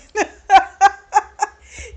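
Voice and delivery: big high laugh that bursts out; cracking up at the story; loud burst of laughter. Real, not acted. Narrator bursts out laughing at his denial.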